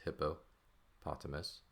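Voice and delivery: "hippopotamus" slowly, split as hippo, potamus; word broken into parts. Shown as a demonstration. Teacher showing the parts of "hippopotamus", word broken in two.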